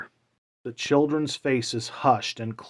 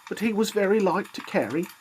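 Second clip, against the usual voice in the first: higher, gentle mother's voice; mother hiding her grief behind a higher voice; 9 semitones higher, faster than usual. A narrator's reading. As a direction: voice the tired mother, lifted high. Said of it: "she resumed".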